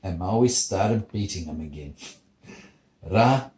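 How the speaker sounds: usual speaking voice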